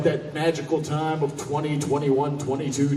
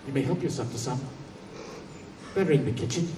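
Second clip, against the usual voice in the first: a hint of a low, softer, formal small man; lightly voiced odd little host; 3 semitones lower, 7 dB quieter, faster than usual. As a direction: play him quietly formal, a touch strange. Tim offers cigarettes.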